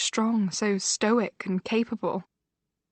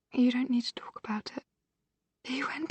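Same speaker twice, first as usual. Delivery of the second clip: whispering; 4 semitones higher, 4 dB quieter than usual. Acted. Gentle whisper to sister.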